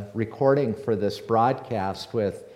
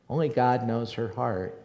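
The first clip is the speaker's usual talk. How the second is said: ordinary delivery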